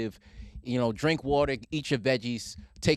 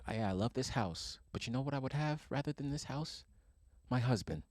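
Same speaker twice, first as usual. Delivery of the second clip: quietly voiced grandma; 11 dB quieter than usual. Grandma answering about the house, quietly voiced. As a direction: say it gently under your breath, as grandma. Retelling a grandma's wistful reply.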